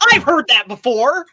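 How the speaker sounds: mock cheerful retold reply. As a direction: answer the Aussie greeting with enthusiasm. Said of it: "I'm like".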